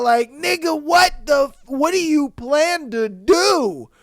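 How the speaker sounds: high, loud, incredulous challenge; outraged disbelief. Real, not acted. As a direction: demand an answer, loudly appalled. Outraged reaction to the threat.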